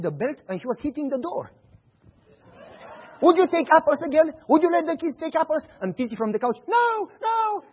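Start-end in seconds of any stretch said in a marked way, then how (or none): 3.2-5.6 loud, high pretend scolding; father faking a beating, loud and stern; mock stern threat. 6.7-7.6 high whimpering no; fake crying. Boy faking cries.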